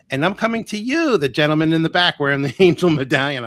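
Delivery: high, loud call to someone at the back; stage medium calling out. Retold or imitated. Psychic picks a man out of her audience, calling out high.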